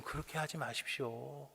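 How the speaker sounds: ordinary talk, nothing marked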